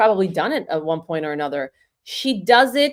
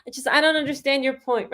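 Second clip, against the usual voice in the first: higher, dismissive Kamala voice; Kamala impression; 7 semitones higher, 3 dB quieter than usual. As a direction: brush off the question like Kamala. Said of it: "she laughs it off and she goes".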